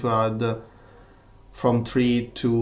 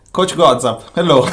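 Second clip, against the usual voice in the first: excited call; 4 semitones higher, 11 dB louder than usual. Real, not acted. Greets a viewer by name.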